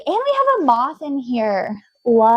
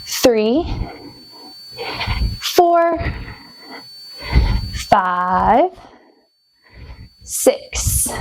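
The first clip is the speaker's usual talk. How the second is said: heavy breathing; much slower than usual. Real, not acted. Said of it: "the breathing is gonna be heavy".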